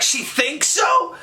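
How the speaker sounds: high, drawn-out disbelieving question; incredulous echo. Retold or imitated. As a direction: repeat it back in outraged disbelief. Disbelief at witness's unsure identification.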